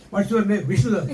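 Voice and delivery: laughing through a mock interview; amused role-play. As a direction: act both parts, barely holding back laughter. Acts gatekeeper exchange, amused.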